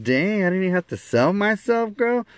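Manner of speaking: high-pitched playful protest; mock surprise. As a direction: pipe up high in mock disbelief. Teasing reply to her yes.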